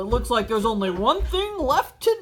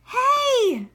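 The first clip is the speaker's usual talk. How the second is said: sharp high yell; indignant shout; 10 semitones higher, 4 dB louder than usual. Acted. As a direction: cry out in outrage. Squidward shouts at SpongeBob.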